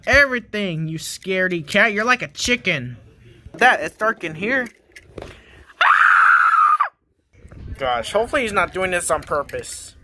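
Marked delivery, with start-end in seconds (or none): 5.8-6.9 sudden high-pitched shriek of fright; shrill scream. Acted. Startled dad sees the drain, screaming high. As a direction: scream like the drain just jumped at you. Dad jump-scares in dark.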